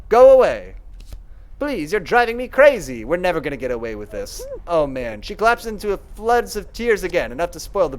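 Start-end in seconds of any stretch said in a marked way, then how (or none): 0.1-3.0 much louder, a desperate cry to be left alone; distraught shout. Acted. Clarissa driving Veronica off, loud desperate cry. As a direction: scream it out in despair, then plead.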